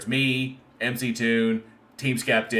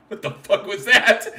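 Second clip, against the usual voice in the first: higher, louder, laughing disbelief; shocked outburst; 8 semitones higher, 6 dB louder than usual. Retold or imitated. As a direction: blurt it out in stunned disbelief. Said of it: "he's like".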